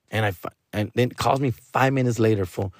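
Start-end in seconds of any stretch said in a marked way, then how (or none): none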